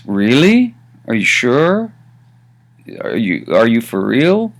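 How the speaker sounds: "Really?" and "Are you sure?" sweeping upward at the end; questioning. Shown as a demonstration. Teacher translates the question version, rising sharply on "Really", "sure" and "real". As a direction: ask with a big doubtful rise on "Really", "sure" and "real". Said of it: "as a question".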